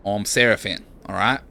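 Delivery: put-on light Australian accent, casual; lightly voiced Australian bloke; Aussie accent. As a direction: give him an easy Aussie twang. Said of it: "decided that Seraphin is Aussie".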